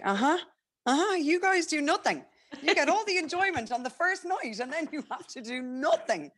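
very high-pitched, emphatic scolding; mock telling-off. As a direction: playfully lay into a partner, voice way up. Retold telling-off of partner.

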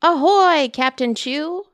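loud pirate holler; hearty ahoy holler; hollered greeting. Acted. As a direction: call across the deck. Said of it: "Emma hollered".

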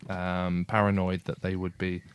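ordinary delivery

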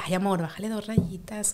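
soft, easy request; gentle version of bájale dos rayitas; calm, gentle. Shown as a demonstration. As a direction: say it tenderly, no edge. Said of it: "es un abanico de sonidos".

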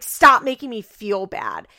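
loud, harsh imitated snap; mock harsh telling-off. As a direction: bark it at her mother. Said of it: "really said it in a very harsh tone".

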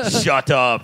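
lightly imitated killer robot; blunt command as a mock action-film cyborg; hint of a robot enforcer; Terminator. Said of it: "Carl walks up like the Terminator".

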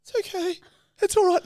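very high teary friend voice; imitating friend. Friend in tears forgives the prankster, imitated high and thin. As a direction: mimic her brave little reassurance. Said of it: "She's like".

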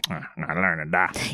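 low, soft, slow mumbled refusal; dismissive mimicry. Imitating friend who refused to learn Dutch, dismissive.

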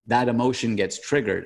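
ordinary talk, nothing marked

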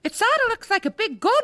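put-on high eager child voice; excited child. Niece remarks on the hairdryer, high and eager. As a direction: blurt it like a curious kid.

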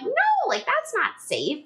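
insistent refusal, slowing on "that's not safe"; retold protest. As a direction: object firmly, pitch leaping up on "No". Acted protest to husband in retold quarrel.

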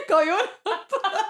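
high, silly advert catchphrase voice; mock advert catchphrase. Parodying a phone-number advert, mock catchphrase voice. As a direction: put on a high comic advert voice.

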